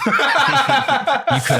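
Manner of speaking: breaking into high laughter mid-sentence; laughing. Real, not acted. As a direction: laugh through the words. Laughing while telling.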